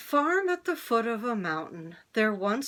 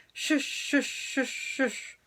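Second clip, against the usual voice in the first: swimming splash sound; 5 semitones higher, much slower than usual. Acted. Coyote swimming through pond.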